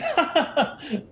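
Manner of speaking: high-pitched theatrical laughter; showy laugh. Acted. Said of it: "in like, a, a display of laughter".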